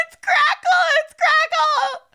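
very high, loud mock wail; imitating a child's tearful scream; crying little girl. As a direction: shriek it like a hysterical little girl. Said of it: "the little girl starts crying".

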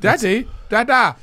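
put-on child voice. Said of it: "He'd be like".

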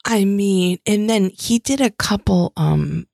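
usual speaking voice

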